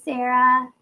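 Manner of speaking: usual speaking voice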